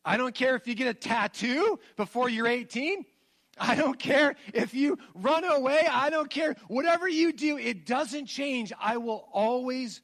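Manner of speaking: escalating fervent vow to daughters; impassioned promise. Retold or imitated. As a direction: pour it out to your daughters, building. Father promising daughters unconditional love.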